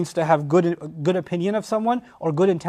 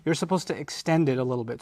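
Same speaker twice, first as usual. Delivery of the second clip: usual speaking voice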